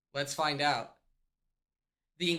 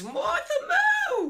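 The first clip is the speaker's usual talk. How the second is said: very high, excited dog greeting; excited squeal; slower than usual. Real, not acted. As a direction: squeal her name at the dog.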